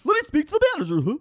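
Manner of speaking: high goofy clown prank caller; Ronald McDonald. Acted. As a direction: make a crank call as a dopey clown. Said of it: "Okay, make the crank call".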